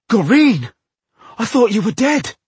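loud, high horrified shout; shocked cry. Acted. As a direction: cry out in sudden horror. Dwarf startled, wounds friend.

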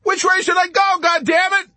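suffering, high, loud yell; furious high-pitched shout. A narrator's reading. Pilot McWatt over the intercom, furious high-pitched shout. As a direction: shout it in anguished fury. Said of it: "shouted furiously over the intercom in a suffering, high-pitched voice".

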